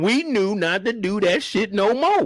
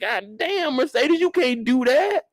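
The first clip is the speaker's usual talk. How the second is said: outraged reaction; 6 semitones higher than usual. Retold or imitated. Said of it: "I'm like".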